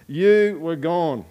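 very slow, weighty "You were gone"; preacher stating a hard truth; slow solemn. Real, not acted. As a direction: let each word land slowly.

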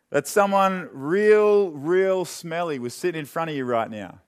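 real stretched slow and loud; stress on real. Acted. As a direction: lean hard on real, drawn out.

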